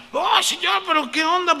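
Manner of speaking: high, loud protest at the Lord; hammed-up outraged believer; mock complaint to God. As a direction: cry out to God in exaggerated dismay.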